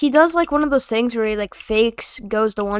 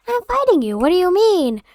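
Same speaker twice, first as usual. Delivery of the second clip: put-on much higher, indignant kid; mocking kid voice; 7 semitones higher than usual. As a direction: imitate the kid defending himself. Kid insisting he is fighting.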